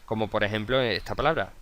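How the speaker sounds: ordinary talk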